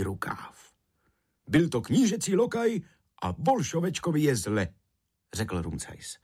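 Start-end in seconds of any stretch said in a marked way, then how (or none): 1.5-4.7 high, animated character voice; urgent realisation. A narrator's reading. Rumcajs realising the water sprite is in trouble, urgent. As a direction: tell the boy of the trouble, voice lifted. Alarmed deduction about the sprite.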